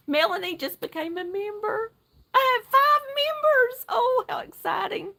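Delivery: barely containing her joy; overexcited. Real, not acted. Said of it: "let me calm down".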